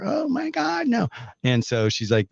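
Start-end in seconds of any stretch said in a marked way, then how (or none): 0.0-1.1 put-on very high, squeaky old lady exclaiming; older lady voice. Host imitating an elderly caller on the phone, very high older lady voice. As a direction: play the flustered elderly caller, pitched way up. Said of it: "my older lady voice here?".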